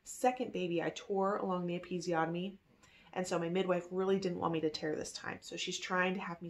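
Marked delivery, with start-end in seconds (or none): none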